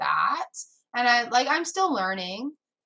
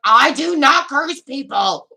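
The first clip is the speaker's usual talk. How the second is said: loud, slow, emphatic announcement; emphatic declaration; 3 semitones higher, 9 dB louder, slower than usual. Acted. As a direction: proclaim it loudly, each word drawn out. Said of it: "That's my voice today".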